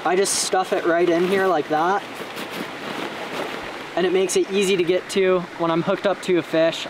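ordinary talk

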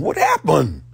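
normal talking voice

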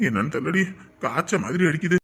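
low, soft sickly complaint; feverish weak voice. Acted. Feverish husband scolding wife from bed, low and weak. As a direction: groan it out from the sickbed.

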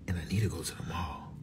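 hushed whisper to the camera. Real, not acted. Whispering her plans to the viewers, hushed. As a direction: breathe it as a secret.

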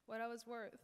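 usual speaking voice

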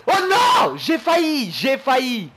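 high anguished yell, easing off; real loud frustration. Real, not acted. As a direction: cry out over the near miss. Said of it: "On va arrêter un peu de gueuler".

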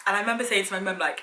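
usual speaking voice

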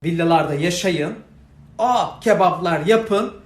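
loud mocking tirade; sarcastic angry rant. Real, not acted. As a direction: spit sarcasm at the rich, loudly.

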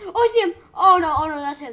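high scared shout; terrified scream. Real, not acted. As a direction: yell in sudden terror. Suddenly sees the killer close.

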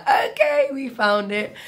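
spotting the venue queue at last, excited; relieved excitement. Retold or imitated. Friends finally finding the concert venue, excitedly relieved. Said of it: "and we were like".

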